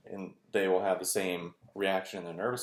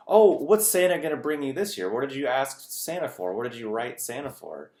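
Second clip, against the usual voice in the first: mock reporter to child; 6 semitones higher, 7 dB louder than usual. News person asks child about Santa.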